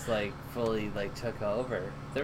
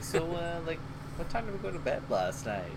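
casual; 5 semitones higher, 5 dB quieter than usual. Retold or imitated. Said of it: "just casually being like".

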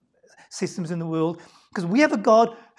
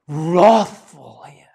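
much louder and very slow on wrathful here; slow heavy stress; 3 semitones lower, 12 dB louder, much slower than usual. Shown as a demonstration. Preacher explaining the strong term, slow loud emphasis. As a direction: lean hard into wrathful.

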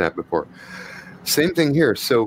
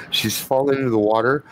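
ordinary delivery

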